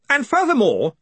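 high, self-important indignation; righteous indignation. A narrator's reading. Stoley carries on oblivious, high righteous indignation. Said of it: "in his own little world of righteous indignation".

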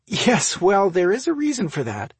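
high, loud amusement; amused, laughing. A narrator's reading. Sister explaining the cake smell, amused. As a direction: lift it as if about to laugh. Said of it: "Ruth laughed".

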